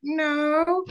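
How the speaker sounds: very high, sad little no; mutant guy. Retold or imitated. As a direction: squeak a tiny no. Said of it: "he's like".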